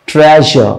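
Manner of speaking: ट्रेजर with a soft whistling zh in the middle; soft zh sound. Shown as a demonstration. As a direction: let the zh in ट्रेजर hiss softly like a whistle. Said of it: "हल्का सा सीटी मारता हुआ जो sound". (ट्रेजर में हल्की सीटी जैसी झ़ की आवाज़)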